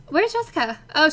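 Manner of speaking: ordinary talk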